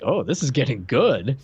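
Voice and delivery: usual speaking voice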